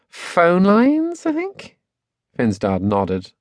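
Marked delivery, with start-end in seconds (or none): 0.1-1.7 very high, tentative upward drift; unsure answer. A narrator's reading. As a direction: let the guess float up high, unsure. Girl unsure of father's job.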